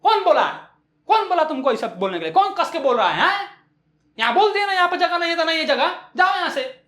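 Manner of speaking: acted indignant telling-off; shouting across town. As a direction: holler as if across the city. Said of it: "side से दूसरे side की ओर चिल्ला के बोल रही है".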